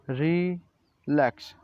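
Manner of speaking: रिलैक्स said slowly, ri-laks, syllables apart; slow pronunciation demo. Shown as a demonstration. Teacher modelling the word slowly. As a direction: stretch रिलैक्स for the class. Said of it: "कैसे pronounce करते हैं".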